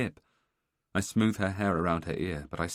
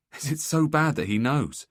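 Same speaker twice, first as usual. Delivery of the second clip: anxious pleading; 8 semitones higher, 7 dB louder than usual. Acted. Husband pleads with crying wife.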